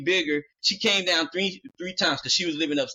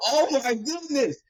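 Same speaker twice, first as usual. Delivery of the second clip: slow, high, drawn-out disbelief; exasperated; 8 semitones higher, slower than usual. Retold or imitated. Reacting to his sister's dare, slow high disbelief. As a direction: stretch it out, unable to believe it. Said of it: "I'm like".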